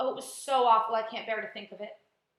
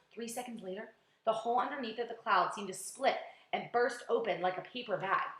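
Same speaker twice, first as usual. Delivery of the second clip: usual speaking voice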